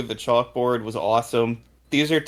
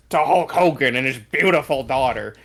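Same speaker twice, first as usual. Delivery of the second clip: mock JR outrage; 4 semitones higher, 5 dB louder than usual. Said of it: "and now he's like".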